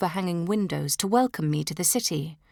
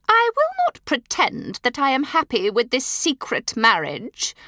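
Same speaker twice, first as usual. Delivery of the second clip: a haughty, louder, higher rebuke; rude; 8 semitones higher, 5 dB louder than usual. A narrator's reading. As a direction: put her down coldly. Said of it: "the Dowager Duchess says rudely".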